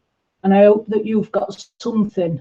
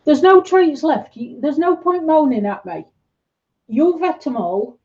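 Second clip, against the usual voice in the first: high-pitched telling-off, as to a pet; mock scolding; 8 semitones higher than usual. Real, not acted. Streamer to a pet begging for treats, high-pitched scolding.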